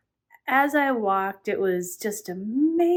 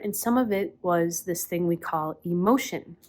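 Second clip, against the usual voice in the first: normal talking voice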